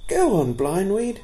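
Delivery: a cheeky fawn egging him on; bold fawn. A narrator's reading. Fawn urges storyteller.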